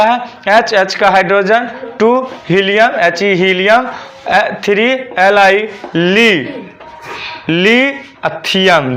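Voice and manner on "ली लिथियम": Lithium as long ली, pause, then Thiem; syllable by syllable. Shown as a demonstration. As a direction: break lithium slowly into stretched chunks. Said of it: "एकदम syllable खंड". (Lithium को खंड-खंड करके, धीरे)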